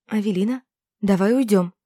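usual speaking voice

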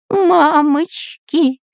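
high, slow, drawn-out cowardly wail; trembling fright. A narrator's reading. Spy hears the ghost answer, comic high fright. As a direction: quail in a high stretched voice. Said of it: "затрепетал шпион Дырка".

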